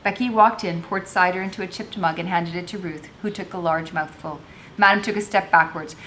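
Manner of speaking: reading a novel aloud to the class with voiced characters; expressive read-aloud, acting out each speaker; story narration. A narrator's reading. Teacher reading Chains to students, expressive narration.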